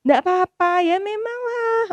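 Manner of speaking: high soothing fake "it's ok"; mock self-consolation. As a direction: pretend you're fine, sugary. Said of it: "Aku nggak bisa bilang kayak".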